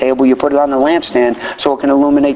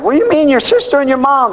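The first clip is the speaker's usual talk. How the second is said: mock surprise; 13 semitones higher than usual. Everybody's puzzled reaction voiced.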